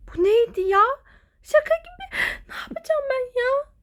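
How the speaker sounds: high, stunned disbelief; incredulous. Acted. Girl alone in the ward, high and incredulous. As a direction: squeak it out in disbelief.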